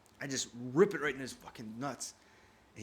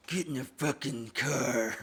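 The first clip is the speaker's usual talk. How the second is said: slow, loud, drawn-out furious order; mad mom; 2 semitones higher, 5 dB louder, slower than usual. Retold or imitated. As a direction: imitate mom seething, cross-eyed with rage. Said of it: "When she gets mad".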